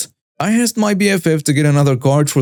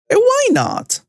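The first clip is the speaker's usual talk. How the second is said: very high, shocked entitled squeal; mock Karen's outrage with a gasp, way up high; fake-gasping Karen. Karen gasping at being refused, hammed-up high squeal. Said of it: "Karen with a fake gasp".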